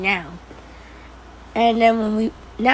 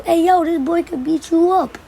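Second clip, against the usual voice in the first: higher, raised-voice retold challenge; re-enacted playground taunt, pitched up; taunting call; 7 semitones higher, 4 dB louder than usual. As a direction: call it out to goad a friend. Retold calling out to tough friend.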